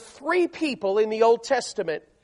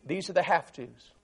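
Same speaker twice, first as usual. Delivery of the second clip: usual speaking voice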